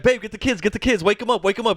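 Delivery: a toned-down hint of alarm; lighter mock urgency. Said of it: "little joke where he's like".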